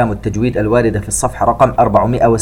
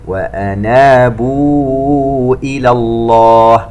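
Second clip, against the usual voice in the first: slow chanted tajweed recitation; Quran recitation; 4 dB louder, much slower than usual. Acted. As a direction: draw out each vowel, chant it. (تلاوة مرتلة بطيئة)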